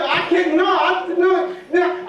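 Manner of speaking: put-on high, flustered stammering kid; caught-out child protesting high; panicked denial. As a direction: scramble to deny it. Panicked kid denying blame.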